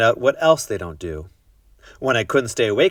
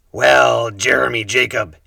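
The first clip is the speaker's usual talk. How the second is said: pirate voice; 2 semitones higher, 7 dB louder than usual. A narrator's reading. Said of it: "he said".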